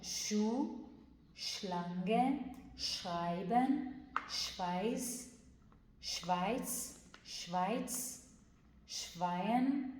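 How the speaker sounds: شلانگه slow with a strong sh; firm sh demo. Shown as a demonstration. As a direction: stretch شلانگه, hitting the sh. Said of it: "اونم ش محکمه".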